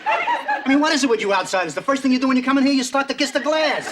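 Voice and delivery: high, mocking disbelief; guard scolding the visitor for kissing glass; disgusted scolding. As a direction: scoff at them in disgust.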